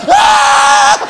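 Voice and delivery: hammed-up deaf shriek; mock shout. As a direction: yell like you've gone deaf. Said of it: "You're like".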